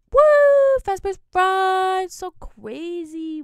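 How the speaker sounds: mock hype. Host hyping a horror attraction, in mock excitement. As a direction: whoop loudly, then stretch it out slowly.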